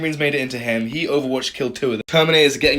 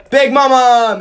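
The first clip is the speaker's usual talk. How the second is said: Big Mama! yelled much higher and louder; loud cheer; 11 semitones higher, 10 dB louder, slower than usual. Real, not acted. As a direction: shout it out to will the dice. Cheering his model before rolls.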